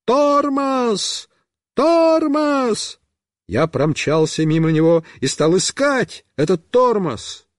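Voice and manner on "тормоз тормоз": high, drawn-out holler; long high-pitched shouted word; yelling. A narrator's reading. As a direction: bellow a warning from a distance, pitched up. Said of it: "и орёт".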